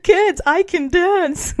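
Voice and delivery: high, slow hammed-up boast of a dorky mom; drawn out, proud and silly, a mom showing off to her kids; mock dorky mom. A mom bragging to her kids that she can dance, hammed up high and slow. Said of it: "mock boast of a dorky mom".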